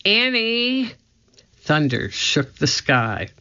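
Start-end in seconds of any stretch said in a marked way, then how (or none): 0.0-0.9 high, drawn-out call. A narrator's reading. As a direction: call after someone running off. Jack calling after Annie.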